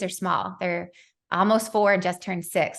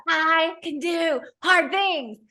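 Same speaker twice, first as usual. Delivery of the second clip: slow, drawn-out high yell of the mantra; screaming mantra; 8 semitones higher, much slower than usual. Retold or imitated. Mom screaming the mantra in the state park. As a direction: belt it out across the park. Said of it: "screaming at the top of my lungs".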